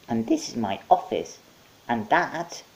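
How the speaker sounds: high, cheerful monkey; chirpy host monkey. Acted. Monkey welcoming Mike with potato hands.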